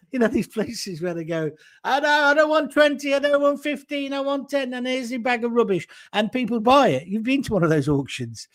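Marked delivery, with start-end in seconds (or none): 1.8-5.9 higher, pushy market trader sales pitch; hammy barker flogging junk to the crowd; mock market seller voice; auction patter.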